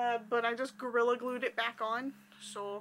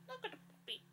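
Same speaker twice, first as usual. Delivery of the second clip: high, very soft coo; 9 semitones higher, 12 dB quieter, faster than usual. Real, not acted. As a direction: barely coo at the puppy. Talking to toy puppy.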